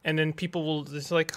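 normal talking voice

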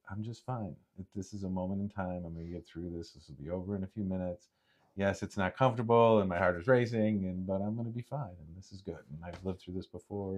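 soft, steady self-talk; calm self-reassurance. Shown as a demonstration. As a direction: quietly talk yourself down from panic. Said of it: "you have to do the opposite of that".

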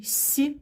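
С palatalized, like "sʲ"; isolated soft С sound; soft consonant. Shown as a demonstration. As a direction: say С softened with an "ee" colour. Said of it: "приголосний м'який".

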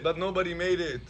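normal talking voice

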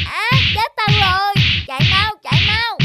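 loud, high panic; panicked flight. Acted. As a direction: yell it while running away. Villains flee in panic.

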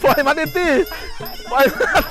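high, laughing shouted encouragement; excited yelling. Real, not acted. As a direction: yell at the fighter to let go.

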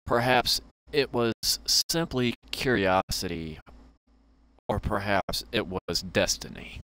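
lightly dramatic storyteller read; a hint of a storybook narrator over the game music; story narration. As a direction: read it like the opening of a tale. Reads the game's narration aloud.

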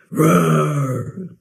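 playful bear roar to end the song; bear growl. Pretend. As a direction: give a mock bear roar. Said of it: "that growl at the end".

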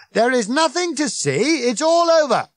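loud, high-pitched crowd control; blaring public announcement; bellowing. A narrator's reading. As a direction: shout officious reassurance over everyone. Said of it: "Ford continued to bellow".

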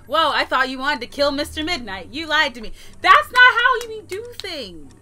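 high, loud outraged telling-off; indignant scolding. Real, not acted. Gamer to friend who faked a kidnapping, indignant. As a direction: scold them loudly, stretching the words.

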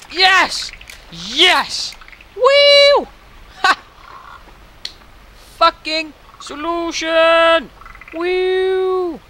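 loud, high whoops of victory; triumphant cheering. Real, not acted. Player solving the puzzle, loud triumphant cheer. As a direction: celebrate like you just won.

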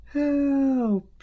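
high, loud cries for help; a lady snatched away, shouting; very high, loud call for help. Acted. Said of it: "she shouted".

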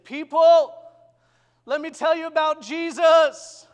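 loud, high shout to a whole store; child's shout. Retold or imitated. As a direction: call out to everyone in the shop.

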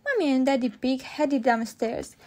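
plain reading voice

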